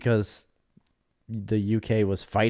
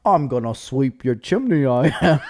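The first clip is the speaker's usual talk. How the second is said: hammed-up Cockney chimney sweep; mock Cockney; 3 semitones higher, 3 dB louder than usual. Friend mocking British beans in a silly Cockney accent.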